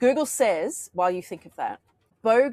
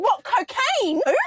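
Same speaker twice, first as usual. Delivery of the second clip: retold disbelief, high, loud and drawn out; mock-startled mishearing, pitched way up and slowed; taken aback; 11 semitones higher, 5 dB louder than usual. Guest mishears "cooking" on a podcast, taken aback. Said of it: "and I said".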